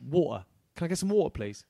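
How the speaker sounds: Water said the London way, dropped t; London accent. Shown as a demonstration. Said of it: "I used to speak with a London accent".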